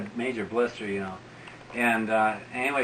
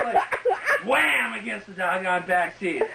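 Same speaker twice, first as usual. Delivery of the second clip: ordinary talk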